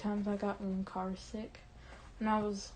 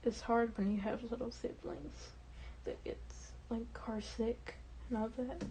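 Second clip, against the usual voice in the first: soft, choked-up worry, fading into near whisper; getting emotional; 9 dB quieter than usual. Real, not acted. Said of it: "I'm getting emotional".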